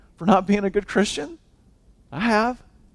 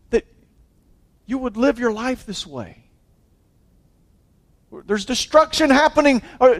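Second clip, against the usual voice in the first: higher, pleading accusation; pained rebuke; 7 semitones higher, 4 dB louder than usual. Retold or imitated. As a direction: ask it like someone hurt by your choices. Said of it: "They'd say, you know".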